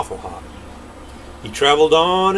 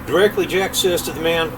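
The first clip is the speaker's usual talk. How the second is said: usual speaking voice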